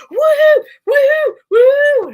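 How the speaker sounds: very high, loud mock dog howl; excited dog whooping. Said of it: "my dog's just like".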